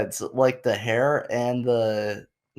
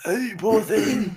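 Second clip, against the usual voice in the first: cooing sympathy; 10 semitones higher, much slower than usual. Pretend. Mock sympathy for lost voice.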